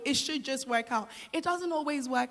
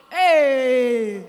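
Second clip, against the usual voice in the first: playful shout; 13 dB louder than usual. Real, not acted. Jokey warning to the crowd.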